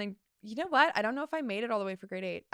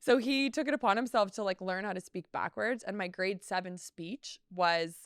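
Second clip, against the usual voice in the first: ordinary talk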